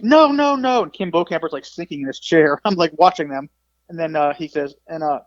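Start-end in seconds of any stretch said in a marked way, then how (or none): 0.0-0.8 high, loud imitated "no"s; flustered protest. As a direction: imitate a rattled interviewer trying to stop the insult.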